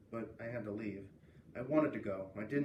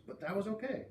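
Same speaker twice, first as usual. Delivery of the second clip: usual speaking voice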